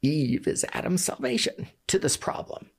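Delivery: high voice catching with real emotion; choking up. Real, not acted. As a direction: fight back a lump in the throat. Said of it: "Sorry, I'm choking up".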